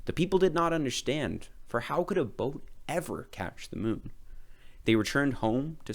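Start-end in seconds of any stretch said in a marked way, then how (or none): none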